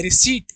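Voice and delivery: रिसीट repeated with silent P; teacher's model of रिसीट ending in a plain T; correct pronunciation. Shown as a demonstration. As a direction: say रिसीट with long "ee", no P. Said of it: "रिसीट पढ़ते हैं".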